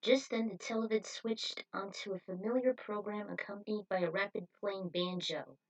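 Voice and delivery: plain reading voice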